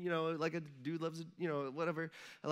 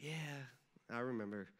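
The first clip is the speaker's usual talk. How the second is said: much softer and lower; sheepish reply; 5 semitones lower, 10 dB quieter, slower than usual. Pretend. Matthew answering Jesus, soft and low. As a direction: mumble it like a caught disciple.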